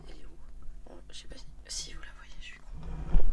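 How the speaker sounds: hushed whisper while searching around. Real, not acted. Whispering to viewers while looking for her cat. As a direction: breathe the words, no voice at all.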